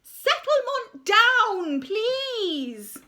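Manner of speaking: high, loud, slow call for order; teacher's shout. Acted. Teacher calling the giggling hall to order, loud and high. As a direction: draws it out over the noisy hall. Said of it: "shouted the teacher".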